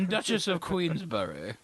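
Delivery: high, slow, hammed-up royal English voice; exaggerated Queen's English; mock Queen's accent. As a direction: announce a title like a pompous queen. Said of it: "That's my Queen's accent".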